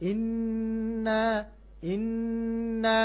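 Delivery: ইন্না slow, nn held in the nose; nasal ghunna. Shown as a demonstration. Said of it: "এখানে নু গুন্না হবে".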